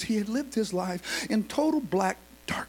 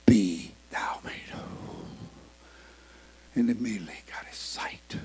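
much softer, lower, breathy whisper, slowed; hushed whisper; 11 semitones lower, 6 dB quieter than usual. Acted. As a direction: breathe the words of healing. Jesus speaks, healing moment.

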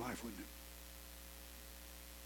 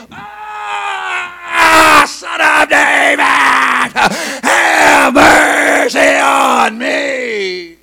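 loud, higher shout, mercy stretched very long; violent cry for mercy; 7 semitones higher, 13 dB louder, much slower than usual. Acted. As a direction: cry out with everything inside. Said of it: "he got really violent in his crying out".

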